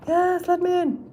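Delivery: high, far-off voice begging to come in; a spirit pleading to be let in; pleading at the door. Pretend. Spirit at the door begs her, high pleading voice.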